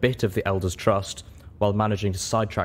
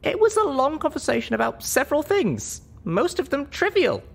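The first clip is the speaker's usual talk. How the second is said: put-on much higher, lighter young mare voice; a high young pony heroine choosing her words; heroine's voice. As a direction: speak up as the small, earnest heroine.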